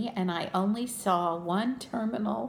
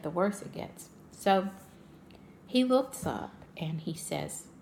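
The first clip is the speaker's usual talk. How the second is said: ordinary talk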